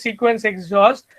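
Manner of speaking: usual speaking voice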